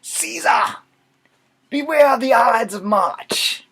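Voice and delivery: very high, loud theatrical cry; old beggar. Acted. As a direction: holler it like a hammed-up soothsayer. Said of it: "And I say".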